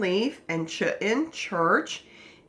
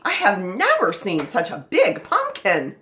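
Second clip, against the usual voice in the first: amazed, punching the key words; amazed exclamation; 4 dB louder than usual. Shown as a demonstration. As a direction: exclaim it in wide-eyed amazement. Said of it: "You wouldn't read it that way".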